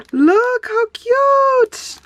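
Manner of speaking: very high, drawn-out coo; slow squealing coo. Real, not acted. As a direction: stretch it out in awe. Cooing over cards.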